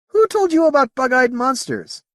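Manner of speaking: high-pitched startled question; exclaiming. Acted. Whitbread questions the alien, high astonished exclamation. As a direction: burst out with it, pitched right up. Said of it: "Whitbread exclaimed".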